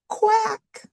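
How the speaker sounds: high, cried-out duck quack. Pretend. Duck voiced as author joke.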